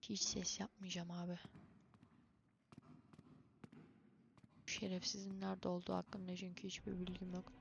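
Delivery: hushed talk. Real, not acted. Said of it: "Bundan sonra sessiz oluyoruz".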